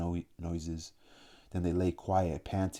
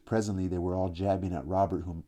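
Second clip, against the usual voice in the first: usual speaking voice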